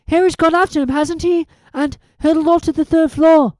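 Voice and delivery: put-on quick and earnest, a bright know-it-all girl; Hermione's voice.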